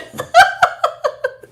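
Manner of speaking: high, loud giggle; quick high-pitched laughing fit; burst of laughter. Real, not acted. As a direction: burst out in a short high cackle. Laughs at viewer's comment.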